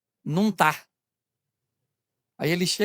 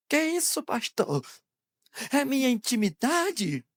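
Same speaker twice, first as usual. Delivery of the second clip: higher, slowed indignant protest; mock-shocked objection; 4 semitones higher, 5 dB quieter, slower than usual. Church member protesting to the pastor about intimacy, mock-shocked.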